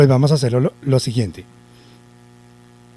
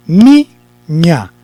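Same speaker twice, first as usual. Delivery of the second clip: "niña" slowly split into ni, ña; syllable by syllable; 3 semitones higher, 7 dB louder than usual. Shown as a demonstration. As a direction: say niña in two separate, slow syllables. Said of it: "entonces la palabra dice". (niña separada en sílabas, lentamente)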